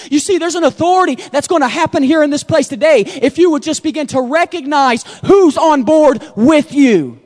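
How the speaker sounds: ordinary talk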